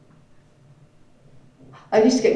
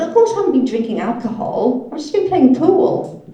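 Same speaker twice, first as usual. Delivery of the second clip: louder, higher insistent denial; indignant defence; 7 semitones higher, 7 dB louder than usual. Retold or imitated. Telling her family she only played pool, loud protest. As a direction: protest innocence loudly.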